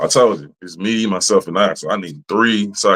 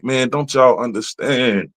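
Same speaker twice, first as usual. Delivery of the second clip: slow pleading quote; 3 semitones higher, slower than usual. Retold or imitated. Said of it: "I'm like".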